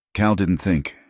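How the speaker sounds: usual speaking voice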